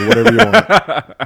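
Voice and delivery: high, loud, hammed-up yes; mock eager agreement. Boyfriend agrees to the girl's bargain, mock eagerness.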